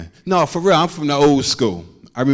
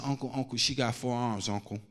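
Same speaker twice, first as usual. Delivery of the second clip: very soft, frightened little voice; hushed scared child; 3 semitones lower, 11 dB quieter than usual. Retold or imitated. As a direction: whisper it to uncle like a spooked kid. Scared child whispering to uncle.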